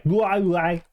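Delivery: a mild copy of that singing way of speaking; imitating singer's style. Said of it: "is that their singing style".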